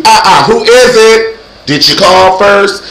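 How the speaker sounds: high, loud challenge through a door; challenging callout. Acted. Challenges an uninvited guest at the door, loud high callout.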